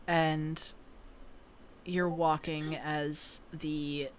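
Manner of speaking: slow, soft, hushed scene setting; eerie narration. Acted. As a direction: draw players into the dead silence. Game master describes eerie path.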